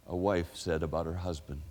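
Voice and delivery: ordinary talk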